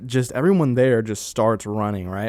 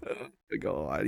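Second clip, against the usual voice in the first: much softer, under the breath; muttered dismay; 2 semitones lower, 11 dB quieter than usual. Retold or imitated. Feeling bad for the crying friend, softly to himself. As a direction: let it slip out quietly, guilty. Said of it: "And I'm like".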